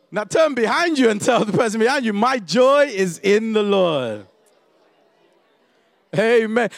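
loud, rising preacher leading the congregation; preaching call and response. Acted. Preacher calling the congregation to tell their neighbours, full-voiced.

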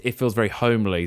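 ordinary delivery

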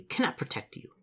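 chilly, pointed refusal; icy. A narrator's reading. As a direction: refuse coldly, as an offended spirit. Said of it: "says the spirit icily".

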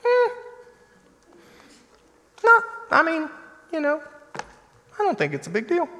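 high, offhand brush-off; unbothered friend shrugging off your worry; dismissive friend. Retold or imitated. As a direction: play the friend who shrugs it off. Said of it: "friends that are just like".

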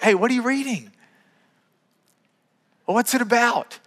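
light, friendly question to a stranger; friendly opener. Shown as a demonstration. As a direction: casually ask a stranger, then follow up. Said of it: "you look".

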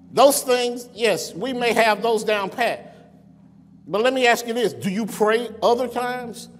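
building sermon cadence, calling them out; rising preaching. Acted. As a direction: press the congregation with every question.

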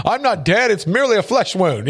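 a hint of the wounded knight shrugging it off; Monty Python quote. Quoting Monty Python to the listeners, lightly mock brave. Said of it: "kinda reminds me of Monty Python".

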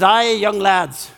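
put-on drawn-out Scottish accent. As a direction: imitate an old Scottish professor, slowly. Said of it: "this great Scottish accent".

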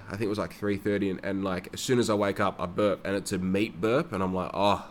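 normal talking voice

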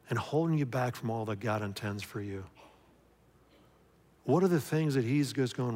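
ordinary delivery

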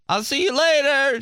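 bright high-pitched farewell; high girly voice. Retold or imitated. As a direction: chirp it like a cheery girl. Girl leaving, retold.

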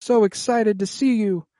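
high, eager squeal of a name; a little fan overjoyed to see his hero; excited kid voice. Retold or imitated. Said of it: "he's just like".

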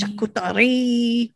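slow, hammed-up French cartoon voice; cartoon Frenchman. Host echoing French word, hammed-up cartoon Frenchman. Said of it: "You sounded like that one cartoon guy".

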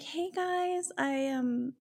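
high, put-on polite hello; chirpy greeting. Girlfriend at the parents' door, innocently chirpy. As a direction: imitate an innocent, tentative greeting.

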